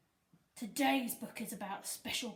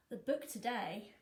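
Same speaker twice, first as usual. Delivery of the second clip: plain reading voice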